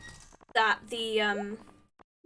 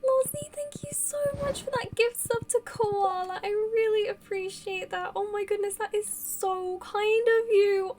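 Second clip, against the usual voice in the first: overwhelmed gratitude; 7 semitones higher than usual. Real, not acted. Streamer thanking a viewer for a gift sub, overwhelmed gratitude. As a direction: gush your thanks in a high, touched voice.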